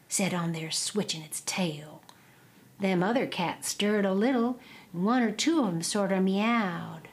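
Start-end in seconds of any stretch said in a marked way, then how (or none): none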